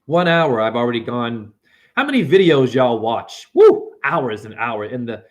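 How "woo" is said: high loud whoop of glee; excited whoop. Real, not acted. Preacher whooping at his audience, excited high woo. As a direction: let out a sharp high woo.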